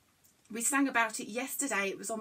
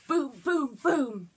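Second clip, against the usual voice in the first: booming demo; 2 semitones higher, 4 dB louder, slower than usual. Shown as a demonstration. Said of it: "see if I can do the boom booms".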